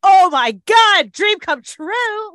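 high, loud drawn-out gush; excited. Retold or imitated. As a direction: re-enact overjoyed disbelief, stretching the words. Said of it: "I was so excited".

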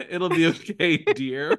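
"it'll be okay" through giggles, mock kindly; laughing comfort. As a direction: retell the stranger's comfort while cracking up. Stranger comforting friend retold, laughing.

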